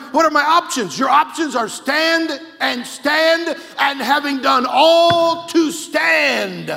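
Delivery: loud, high preaching that builds to a slow shout; full-force preaching. Acted. Preacher urging the congregation to stand up for Jesus, full force. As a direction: drive it home like a fired-up preacher.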